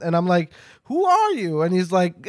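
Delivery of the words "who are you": much higher-pitched, mock-indignant question; indignant challenge. Host to an uninvited vampire, mock indignant. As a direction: challenge the gatecrasher in a high voice. Acts out challenging party crashers.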